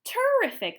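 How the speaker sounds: very high, loud exclamation; delighted. A narrator's reading. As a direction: gush with delight. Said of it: "the egg lady said".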